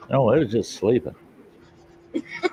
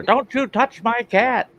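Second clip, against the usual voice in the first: higher, slower, mock-indignant warning; sister's protective voice; 14 semitones higher than usual. Youngest sister warning her brother off her cat, mock indignant. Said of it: "And my youngest sister".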